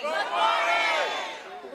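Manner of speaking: stretched-out good morning call; slow drawn-out greeting. Real, not acted. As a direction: draw it out long to the crowd. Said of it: "we can do a little bit better than that".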